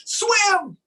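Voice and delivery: high, loud call of Swim; cheering call. Acted. Teacher urging the kids at the end of the book, high loud call. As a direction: shout it to cheer them on. Book's final rallying call.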